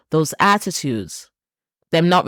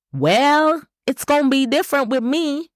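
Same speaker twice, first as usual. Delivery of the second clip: put-on Texan accent; 9 semitones higher than usual. Podcaster mimicking someone sure they're the exception, in a Texan accent. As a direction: put on your best Texan accent, pitched way up. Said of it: "That's my best Texan accent".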